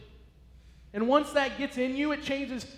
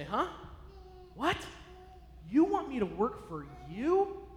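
talking down; 6 dB quieter than usual. Retold or imitated. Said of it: "Almost like talking down to them".

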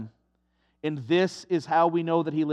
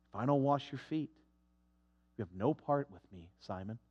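much softer and lower, tender; gentle Jesus; 9 semitones lower, 12 dB quieter than usual. Acted. Jesus answers Peter's refusal, soft and low. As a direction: say it quietly, close to him.

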